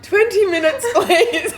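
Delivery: high, laughing polite refusal; laughing plea. Retold or imitated. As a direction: beg off politely while laughing. Retold quick polite refusal, laughing.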